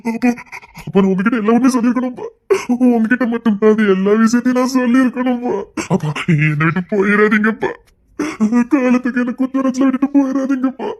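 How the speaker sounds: sobbing plea between gasps; tearful, remorseful lament; weeping apology. Acted. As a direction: beg your father through tears. Weeping, begging father's forgiveness.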